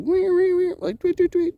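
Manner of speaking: high chirping bird imitation; bird chirps. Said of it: "it was like a bird sound".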